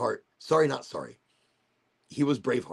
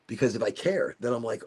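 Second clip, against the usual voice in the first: ordinary delivery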